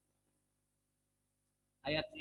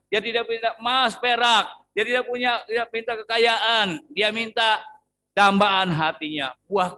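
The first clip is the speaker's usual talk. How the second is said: higher and louder, building sermon point; preaching; 7 semitones higher, 6 dB louder than usual. Real, not acted. Preacher exhorts the church, rising and louder. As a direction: drive the point home to the congregation. Preaching climax on Hana's prayer.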